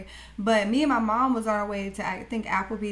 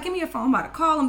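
fast, high, louder mock demand; imitating pissed-off mom; 6 semitones higher, 5 dB louder, much faster than usual. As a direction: rush it out like an outraged mother. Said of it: "she was pissed".

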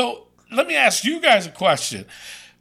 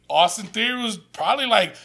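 normal talking voice

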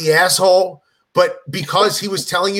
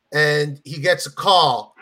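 ordinary delivery

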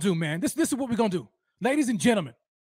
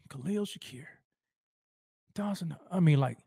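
ordinary talk, nothing marked

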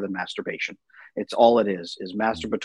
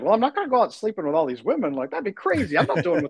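higher, quicker quoted protest; incredulous refusal; 5 semitones higher, faster than usual. Retold or imitated. Husband recalls rejecting the guys' advice, incredulous. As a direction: balk at the idea in a retold inner voice.

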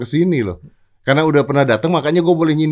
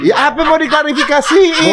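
high, loud, laughing retort; incredulous high-pitched laughing question; laughing protest; 12 semitones higher, 6 dB louder than usual. Real, not acted. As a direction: protest loudly through laughter. Teased host protests, laughing.

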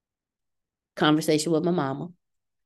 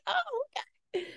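surprised; 9 dB quieter than usual. Retold or imitated. Shocked seeing him online.

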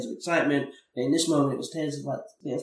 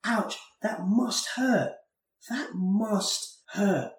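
slow, higher, gently caring "ouch, that must hurt"; sympathetic ouch; 8 semitones higher, much slower than usual. Retold or imitated. Adult consoling a child who grazed his knee, slow and caring. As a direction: show a hurt child you feel it, slowly. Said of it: "that's, like, the human reaction".

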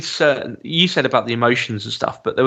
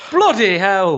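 louder, higher Welsh bloody hell; loud Welsh accent; 7 semitones higher, slower than usual. Retold or imitated. Host mimicking actor's loud Welsh outburst in Welsh accent. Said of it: "very loudly in a Welsh accent".

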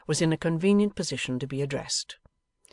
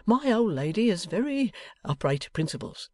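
high, lilting, gentle protest; meek old husband; 7 semitones higher than usual. A narrator's reading. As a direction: defend her mildly and earnestly. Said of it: "Mister Boffin gently represented".

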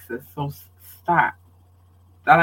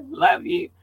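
high-pitched love-you through a laugh; amused, voice jumping up high; 12 semitones higher than usual. Real, not acted. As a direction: let a giggle lift the words. Laughs during the shout-outs.

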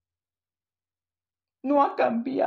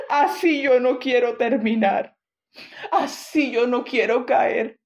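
impassioned preaching; 4 dB louder than usual. Acted. Preaching at full force.

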